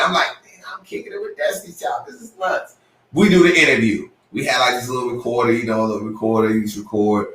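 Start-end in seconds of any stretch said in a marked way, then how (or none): 0.4-2.8 hushed, breathy aside to himself; inner thought. Retold or imitated. Thinking to himself while hanging with the group, hushed. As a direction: whisper it like a secret thrill. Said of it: "I'm like, in my mind".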